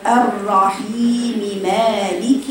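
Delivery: very slow الرحيم مالك, the first م with a full كسرة, the second with a فتحة; clear recitation. Shown as a demonstration. Teacher reciting the correct join to her students. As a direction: stretch الرحيم مالك slowly, sounding each م apart. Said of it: "هنقرأها كالتالي".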